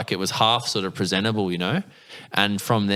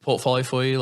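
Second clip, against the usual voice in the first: higher-pitched, placating reply; awkward recovery; 5 semitones higher than usual. Retold or imitated. Hopeful apprentice to an unimpressed boss, awkward recovery. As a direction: press on politely after a rebuff, pitched up.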